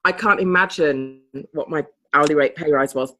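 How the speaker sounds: usual speaking voice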